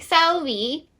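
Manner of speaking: Selby with a rising fake Scottish lilt; mock bad Scottish accent. Mocking the narrator's bad Scottish accent to a friend. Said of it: "They'd be like".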